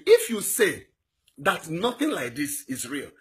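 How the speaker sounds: usual speaking voice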